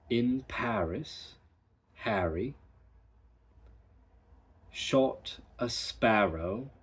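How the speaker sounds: slow, careful British Paris, Harry, sparrow, arrow; Paris, Harry and arrow with the open British vowel; British practice sentence. Shown as a demonstration. Teacher reads the practice sentence in the British way. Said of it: "So the practice sentence is".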